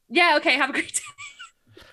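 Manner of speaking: mock-cheerful goodbye from the worn-down staffer; chirpy staff sign-off. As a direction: chirp it out like a relieved employee. Employee caving, retold.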